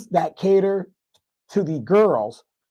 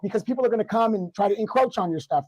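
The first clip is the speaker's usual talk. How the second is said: ordinary delivery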